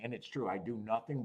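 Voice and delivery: usual speaking voice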